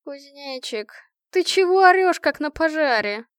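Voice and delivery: high, sleepy protest. A narrator's reading. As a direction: whine like someone just woken. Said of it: "раздался сонный голос Лики".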